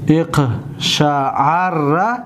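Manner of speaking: اقشعر stretched with a rise at the end; dictating اقشعر slowly; very slow dictation. Shown as a demonstration. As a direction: drag out اقشعر. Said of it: "اقشعر فعل امرش است".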